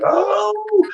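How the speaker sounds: very high howled oh; dog howl. Retold or imitated. Showing how dogs howl at sirens, high howl. Imitating dogs reacting to pitch.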